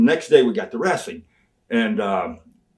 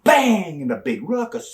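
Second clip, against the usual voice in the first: ordinary talk, nothing marked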